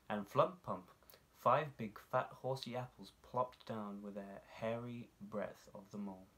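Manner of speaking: usual speaking voice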